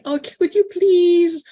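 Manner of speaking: high, loud, slow pleading ask; a high, drawn-out people-pleasing plea. Retold or imitated. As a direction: beg sweetly to please them. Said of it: "that people pleasing, um, style and mode".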